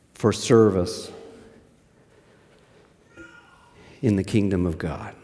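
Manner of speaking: slow, with long pauses between phrases; grave preacher quoting Jesus; solemn emphasis. Acted. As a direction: let each phrase land heavy.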